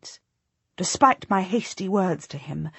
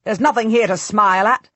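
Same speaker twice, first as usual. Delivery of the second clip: scornful; 4 semitones higher, 7 dB louder than usual. Acted. Edward scorns Anthony.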